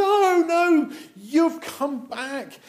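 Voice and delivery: high, loud, drawn-out cry of welcome; overjoyed welcome. Acted. Father hugging his returned son, overjoyed welcome. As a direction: exclaim it high, stretching the words.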